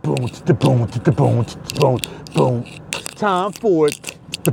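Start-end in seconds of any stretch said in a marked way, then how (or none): 0.0-2.6 low beatboxed boom rhythm; low vocal drum hits. Acted. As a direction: drop the beat with your mouth. Beatboxing a beat at the start.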